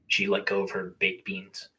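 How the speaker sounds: usual speaking voice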